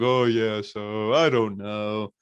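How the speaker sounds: slow, dragged-out mock cluelessness; mock clueless Riddle. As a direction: imitate someone stalling with no idea what to say. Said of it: "He's like".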